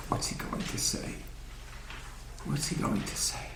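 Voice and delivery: whispered, expectant wondering; hushed anticipation. Acted. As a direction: whisper it like waiting students. Imagined young men awaiting the Rebbe's words.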